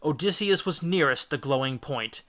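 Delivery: plain reading voice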